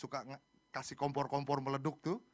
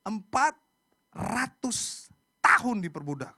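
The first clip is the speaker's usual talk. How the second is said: loud, high outburst with drawn-out words; real emotional emphasis, hammering each word; worked up; 8 semitones higher, 12 dB louder, slower than usual. Real, not acted. Preacher stressing Israel's long slavery, worked up. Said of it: "Sampai emosi nih".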